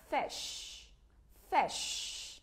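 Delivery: Fish with short i and a hushed sh ending; model pronunciation. Shown as a demonstration. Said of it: "Đọc theo cô nha".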